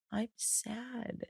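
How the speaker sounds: a soft, high little "I'm sad"; imitating crying friend. Retelling a crying friend's call, soft and high. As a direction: mimic a tearful friend's tiny voice. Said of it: "called me yesterday crying".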